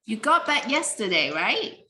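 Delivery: You got back yesterday, right? with right rising; rising confirmation question; rising tone tag. Shown as a demonstration. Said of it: "the question has the rising tone".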